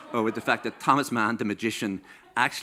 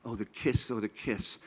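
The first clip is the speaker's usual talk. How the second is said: oh the kiss fading much softer each time; dreamy fade; 7 dB quieter than usual. Pretend. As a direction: sigh it away dreamily. Swooning fade over the kiss.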